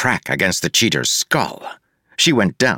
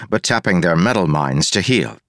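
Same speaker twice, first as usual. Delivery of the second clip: usual speaking voice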